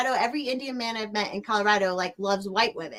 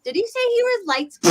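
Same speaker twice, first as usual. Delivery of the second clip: surprised; 11 semitones higher, 4 dB louder than usual. Real, not acted. Incredulous question.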